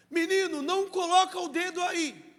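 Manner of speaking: put-on high, loud warning to a child; scolding father. Pastor voicing a father scolding his child, loud and high. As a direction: snap at the kid to keep his finger away.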